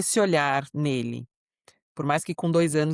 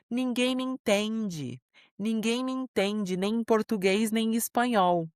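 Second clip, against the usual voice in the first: higher, slow mock wail of a frustrated little boy; frustrated child; 6 semitones higher, slower than usual. Mother retelling her son's meltdown about two languages, higher mock wail. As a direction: play the misunderstood five-year-old, drawn out. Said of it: "aí ele fala assim".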